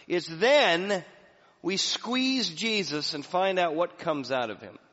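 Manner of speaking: loud, high, driving sermon climax; full-force preaching. Acted. Pastor preaching the cross to the congregation, loud and rising. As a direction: preach it hard and rising to the crowd.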